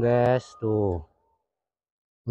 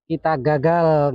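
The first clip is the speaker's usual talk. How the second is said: normal talking voice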